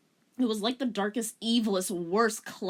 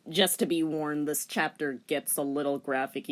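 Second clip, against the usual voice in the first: ordinary delivery, nothing marked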